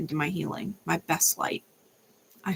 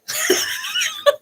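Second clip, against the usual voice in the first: high cackle. Real, not acted. Laugh after warning.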